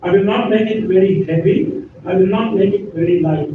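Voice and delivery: ordinary delivery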